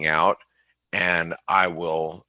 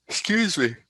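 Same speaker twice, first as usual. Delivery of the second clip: yawning; 13 semitones higher than usual. Real, not acted. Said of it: "I'm just yawning a lot today".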